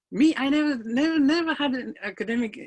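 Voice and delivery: high, loud, stressed never; emphatic disbelief. Real, not acted. Speaker telling audience she lacked schooling, high emphatic stress. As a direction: hammer home the never.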